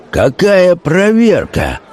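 high, slow indignant exclamation; outraged cry. A narrator's reading. Notary rejecting the check of cards, outraged. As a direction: draw it out high, appalled. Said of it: "закричал, наливаясь кровью".

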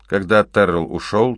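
ordinary delivery, nothing marked

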